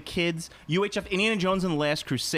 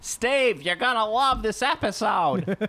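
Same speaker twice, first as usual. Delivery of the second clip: David Lynch; 7 semitones higher, slower than usual. Acted. Doing the director who can't speak, slow high yell. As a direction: yell it slowly, pitched well up. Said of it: "I love that he yells".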